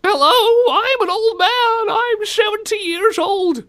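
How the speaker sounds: very high, loud, slow falsetto; badly faked old man. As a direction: introduce yourself in an absurd squeaky voice. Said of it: "passing him off as an old man".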